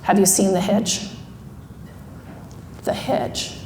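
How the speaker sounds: low, quiet controlled anger; restrained anger. Retold or imitated. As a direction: imitate someone furious but barely raising the voice. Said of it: "this is him really angry at me".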